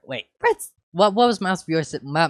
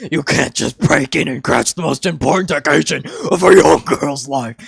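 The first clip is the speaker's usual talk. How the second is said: loud furious yell; 11 dB louder than usual. Acted. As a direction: scream it at them. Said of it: "she yelled at them".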